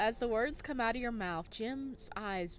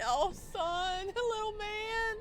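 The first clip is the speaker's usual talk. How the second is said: high, slow teasing through laughter; laughing squeal; 11 semitones higher, 4 dB louder, slower than usual. Real, not acted. Streamer laughing at Jim's reaction, high and teasing. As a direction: coo at him while laughing.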